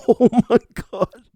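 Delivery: high laughing oh my God; giggly self-mockery; laughing at himself. Real, not acted. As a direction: crack up at your own blank. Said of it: "Am I that tired".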